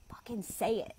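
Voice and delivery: urgent whisper. Real, not acted. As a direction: whisper it fiercely. Urging listener during tapping.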